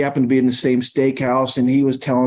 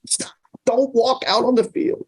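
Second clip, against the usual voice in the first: high, strained mock yell without volume; hushed imitation of shouting; imitated yell; 10 semitones higher than usual. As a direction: mimic a son's urgent warning in a thin, high voice. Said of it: "Cam yelling".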